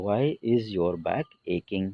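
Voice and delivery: slow English example for the class; slow model sentence. Shown as a demonstration. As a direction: dictate it word by word. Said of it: "तो". (धीरे बोला गया उदाहरण)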